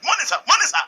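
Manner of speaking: police crowding to greet the deputy commissioner; eager greeting. Retold or imitated. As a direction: hail the big man eagerly.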